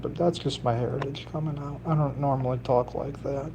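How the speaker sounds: normal talking voice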